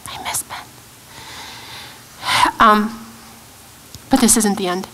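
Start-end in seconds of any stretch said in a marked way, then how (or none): 0.1-0.6 real grief. Real, not acted. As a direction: voice dropping to a faint whisper. Mother grieving her dead son.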